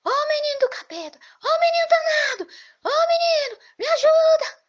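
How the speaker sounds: very high, loud, furious yells; cursing scream. Acted. Priest finally cursing the boy, screaming in fury and pain. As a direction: curse the boy at full voice. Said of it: "Ele teve que xingar".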